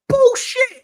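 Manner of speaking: high, loud laughing shout; mock outburst. Scoffing at rival fans, a loud high laughing yell. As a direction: blurt it out in mock outrage, cracking up. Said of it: "I was like".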